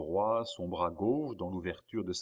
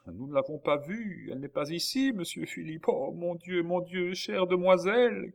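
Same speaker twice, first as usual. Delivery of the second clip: high wail, building louder at the end; tearful cry; 10 semitones higher, 4 dB louder than usual. A narrator's reading. Maid telling the brother she is missing, wailing tearfully. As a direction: cry it out on the edge of sobs. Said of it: "cria Nicole, éclatant en sanglots".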